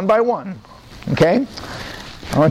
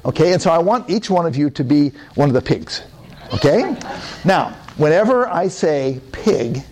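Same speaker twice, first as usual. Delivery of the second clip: ordinary talk, nothing marked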